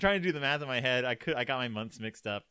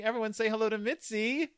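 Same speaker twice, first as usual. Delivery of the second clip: ordinary talk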